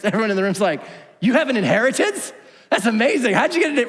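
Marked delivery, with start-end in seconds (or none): 1.2-3.9 loud, higher, laughing incredulity; mock disbelief. Crowd laughing at the greedy man's inheritance, mock amazed. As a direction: scoff in amused disbelief.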